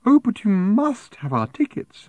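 insist, drawing it out high; primly insistent. A narrator's reading. Woman pressing tickets on Arthur, primly insistent. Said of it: "insisted the woman".

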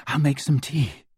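out of breath, with an audible exhale first; breathless. A narrator's reading. Hermione offers to make tea after carrying Ron, breathless. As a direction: puff out the words after an effort. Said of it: "said Hermione breathlessly".

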